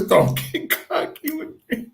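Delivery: very high, put-on voice; high-pitched mimicked warning; mock scolding. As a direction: imitate the boss's rebuke way up high. Rebuke repeated in Chief's voice.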